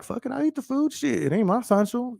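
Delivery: voicing a careless guy. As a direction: toss it off like you don't care. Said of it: "and you're like".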